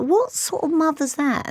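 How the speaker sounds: high-pitched incredulous question; retold indignation. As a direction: ask it aghast, pitch right up. Retold indignation at another mother.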